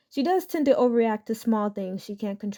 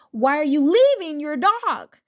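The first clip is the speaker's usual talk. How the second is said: "you" hit higher and louder, "leaving" lifted high; stress on YOU; 6 semitones higher, 5 dB louder than usual. Retold or imitated. Entitled mother accusing the boy, stress on "you". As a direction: lean hard on "you" like an accusation. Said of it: "she put emphasis on the words in all caps".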